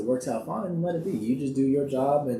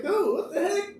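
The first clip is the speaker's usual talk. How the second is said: baffled "dude". Retold or imitated. Retold reaction to co-star.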